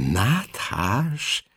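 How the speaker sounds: soft puzzled rising question; surprised. A narrator's reading. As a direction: wonder aloud, baffled. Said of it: "csodálkozott mama". (csodálkozva, halkan)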